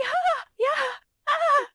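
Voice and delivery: very high, timid little yelp; timid squeak. Retold or imitated. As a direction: squeak it out like a scared newcomer. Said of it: "I was like".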